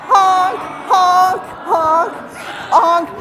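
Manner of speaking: loud, high goose honks; honking goose. Shown as a demonstration. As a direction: honk like a goose for the kids. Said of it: "remember, we're honking".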